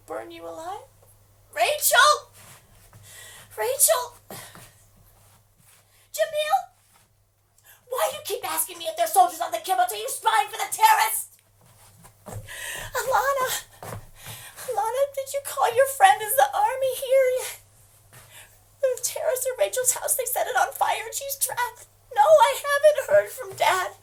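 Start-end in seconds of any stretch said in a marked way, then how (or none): 1.5-6.7 high, loud scream; screaming a name. Acted. Screaming names on phone. 7.9-11.4 loud, fast furious accusing; wife accusing a neighbour of spying; angry accusation. Acted. Accusing Jamil. 12.3-23.9 high, loud panicked crying; mother screaming to daughter in panic; hysterical. Acted.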